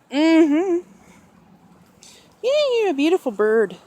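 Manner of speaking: pitched well up, cooing to the bird; high pet talk. Real, not acted. Owner coos to her parrot in a high voice.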